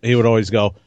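normal talking voice

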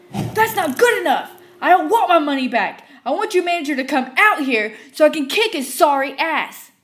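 furious customer shrieking; screaming. Retold or imitated. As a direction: scream it waving the cup. Customer threatening.

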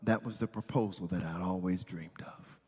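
very low, hushed sarcasm; sarcastic. Retold or imitated. Girlfriend answers an angry proposal, sarcastic. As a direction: murmur it drily, almost whispered.

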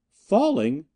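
high, alarmed cry; frightened high-pitched exclamation; panicked echo. A narrator's reading. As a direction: repeat it in horrified disbelief. Crewman reacts in panic.

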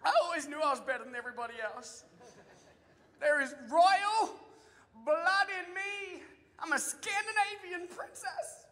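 high, hushed princess swooning; mock excited. People reading their DNA results, mock excited. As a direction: act out giddy self-importance, voice rising high. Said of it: "they get all excited 'cause they're like".

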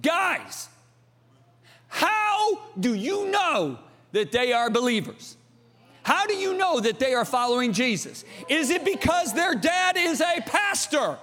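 high, slow, insistent grilling; preacher acting a pleading, challenging dad; dad confronting sons. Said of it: "look at my sons and say".

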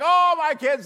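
high, loud gushing parent voice; mock proud parent. Pastor mimics a parent gushing to the congregation about the kids. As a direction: gush like a doting parent.

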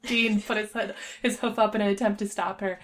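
plain reading voice

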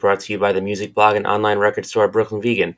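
loud, even ad read; podcast promo read. Acted. Host reading the podcast intro, a loud announcer read. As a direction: announce the show like a radio spot.

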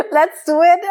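bursting into giggles; laughing through the words. Real, not acted. Telling her friend, laughing helplessly. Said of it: "I have to laugh so hard".

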